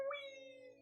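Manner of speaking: ordinary delivery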